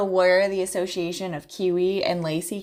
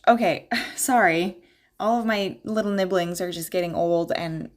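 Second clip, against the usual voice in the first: ordinary talk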